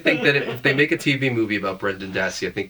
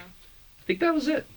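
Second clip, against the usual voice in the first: much higher, slow airy shrug; breezy dismissal; slower than usual. Retold or imitated. Friend acting out dropping old friends, high and slow. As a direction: let it go lightly. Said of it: "and then you're like".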